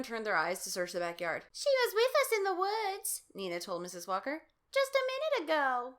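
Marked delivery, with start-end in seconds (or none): 1.5-5.9 put-on high, loud anxious girl. A narrator's reading. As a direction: answer the worried mom urgently. Nina voiced, high.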